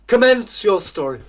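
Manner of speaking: high, loud regal order; royal command. Acted. King ordering the tramp to start his tale, loud and regal. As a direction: command it from the throne.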